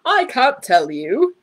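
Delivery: loud and slow, a grand boom. A narrator's reading. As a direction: boom it out like a showman. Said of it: "boomed the second man".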